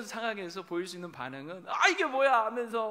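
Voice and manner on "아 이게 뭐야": loud annoyed groan at a surprise; mock frustration over the wrong car; irritated outburst. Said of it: "하면서 막 짜증내고".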